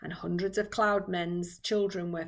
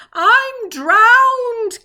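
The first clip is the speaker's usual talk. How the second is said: high, very loud wail; gasped cry; 14 semitones higher, 14 dB louder than usual. A narrator's reading. Old green grasshopper spitting out water, gasping cry. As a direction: cry out as if half drowned. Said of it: "gasped the old green grasshopper".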